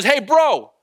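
loud, high call across a park; imitating a stranger's shout; retold yell. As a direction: holler to someone far off. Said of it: "This guy yells across the park".

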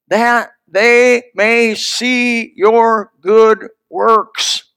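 very slow, stretched scripture reading; solemnly drawn-out. Acted. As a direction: lean on every word of the verse. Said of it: "reciting scripture".

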